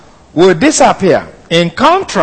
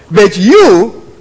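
"you" stretched high, then a pause; emphatic YOU; 3 semitones higher, 4 dB louder than usual. Shown as a demonstration. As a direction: punch "you" and stop at the comma. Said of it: "should be translated this way".

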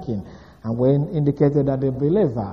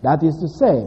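ordinary talk, nothing marked

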